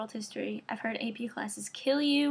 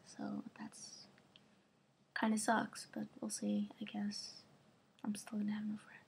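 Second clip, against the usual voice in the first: soft unvoiced whisper, trailing off; whispered aside; 11 dB quieter than usual. Real, not acted. Vlogger whispering a gloomy aside to viewers, hushed. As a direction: mutter it under your breath like a secret.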